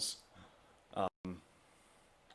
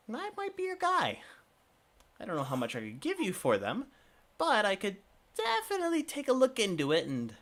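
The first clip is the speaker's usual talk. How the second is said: high merchant voice; 7 semitones higher than usual. Acted. Shopkeeper's pitch voice.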